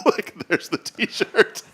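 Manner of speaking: slow, high laughing delivery. Real, not acted. Host shows cohosts the new shirt, laughing. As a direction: laugh while showing it off.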